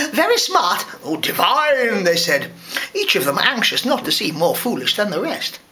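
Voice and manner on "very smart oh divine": high, affected admirers; hammed-up society flattery; gushing onlookers. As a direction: coo admiration in a high put-on voice. Crowd members gush at once.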